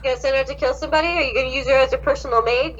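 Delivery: ordinary talk, nothing marked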